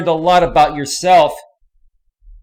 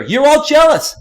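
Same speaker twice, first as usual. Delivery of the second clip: pitched up, louder angry jab; angry outburst; 8 semitones higher, 5 dB louder than usual. Real, not acted. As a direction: throw the accusation loud and high. Ranting at chat viewers.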